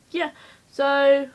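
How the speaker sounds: ordinary talk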